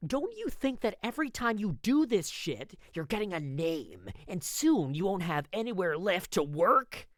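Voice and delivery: high, nasal-free sing-song mimicry of a nagging brother; mocking imitation of a scolding; mocking his voice. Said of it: "I mocked him, mimicking his voice".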